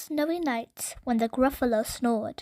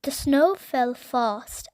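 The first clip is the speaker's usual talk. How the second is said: ordinary delivery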